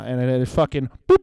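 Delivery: usual speaking voice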